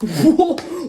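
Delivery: high loud burst of laughter. Real, not acted. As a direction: laugh out hard. Reactor laughs hard.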